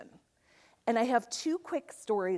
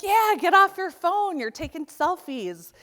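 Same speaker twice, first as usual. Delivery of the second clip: higher, louder playful jab, easing off at the end; teasing retort; 8 semitones higher, 7 dB louder than usual. Retold or imitated. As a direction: tease her about the phone. Husband's teasing reply quoted.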